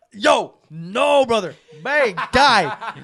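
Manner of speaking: loud, laughing mock alarm; loud protest. Telling how he stopped his roommate, loud mock protest. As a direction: cut him off loudly, half laughing.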